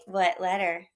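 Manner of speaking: ordinary delivery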